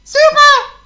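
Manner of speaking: very high loud squeak; top of his squeak. A narrator's reading. Mouse shouts to Potto in the wind, loud high squeak. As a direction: squeak as loud as you can. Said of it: "at the top of his squeak".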